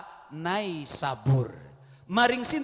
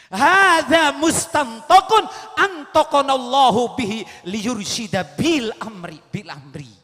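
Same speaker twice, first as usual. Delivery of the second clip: solemn recitation; 3 semitones higher, 13 dB louder than usual. Acted. Arabic saying recited.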